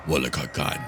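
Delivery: low, deep vampire intonation. Acted. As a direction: growl his name like a vampire lord. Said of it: "That's my new vampire voice".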